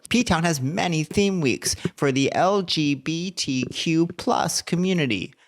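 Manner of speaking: slow, emphatic tourism promo; hammed-up announcer savoring each item on the list; ad read. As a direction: draw out the sales copy, lifting the big phrases. Reads a tourism ad.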